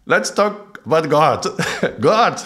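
high and loud, laughing through it; retelling the bold moment while laughing; laughing announcement. Retold or imitated. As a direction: say it again giggling. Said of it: "And I just said it".

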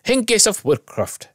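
put-on high, slow shout in a Scottish accent. A narrator's reading. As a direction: call it out like a Scottish crowd, drawn out. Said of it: "everyone shouts in Scottish accent".

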